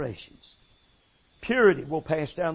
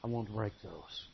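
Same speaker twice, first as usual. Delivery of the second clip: hushed, lower pleading prayer; soft prayer; 6 semitones lower, 6 dB quieter, faster than usual. Shown as a demonstration. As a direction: model the prayer softly for the congregation.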